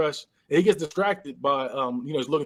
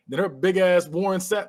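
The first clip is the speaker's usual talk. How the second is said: usual speaking voice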